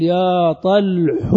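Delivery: "طلح" drawn out as "ṭalḥu"; damma moved onto ح. Shown as a demonstration. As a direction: stretch "طلح" very slowly, ending on "u". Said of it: "وتنقل هذه الضمة إلى ما قبل". (نطق "طلح" بضم الحاء مع المدّ)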